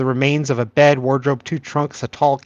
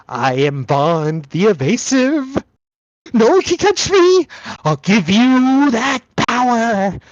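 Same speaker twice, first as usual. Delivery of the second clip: slow, high, boastful tomb spirit; boastful sarcophagus voice; 6 semitones higher, slower than usual. Acted. GM voicing a boasting sarcophagus to the players, slow and high. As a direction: tempt them with power, drawn out.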